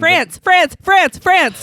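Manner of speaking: loud, high, eager blurting; excited shouting. Real, not acted. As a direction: shout the answer fast and eager. Eager trivia answer shouted.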